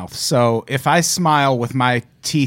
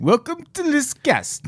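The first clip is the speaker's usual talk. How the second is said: goofy greeting; 11 semitones higher than usual. Pretend. Host echoing the joke greeting, higher goofy voice. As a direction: toss it back in a put-on voice.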